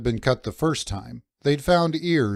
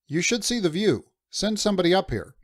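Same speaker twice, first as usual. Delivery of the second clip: shouting up; 7 semitones higher than usual. Acted. Narrator shouting down to the first mate about the view, high and quick. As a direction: shout it higher, a touch quicker. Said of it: "I shouted back".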